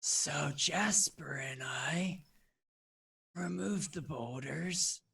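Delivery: breathy, raspy maze creature; rasping, wheezing creature. Acted. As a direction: answer in a wheezing rasp. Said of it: "rasping, wheezing voice".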